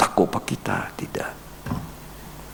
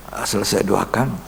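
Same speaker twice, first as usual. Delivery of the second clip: normal talking voice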